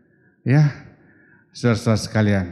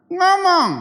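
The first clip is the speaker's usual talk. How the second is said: urging shout; 5 dB louder than usual. Real, not acted. Preacher urging to speak.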